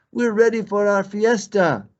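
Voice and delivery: high and loud, eager; bunny hopping with glee; excited rabbit. Acted. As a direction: bounce with party excitement.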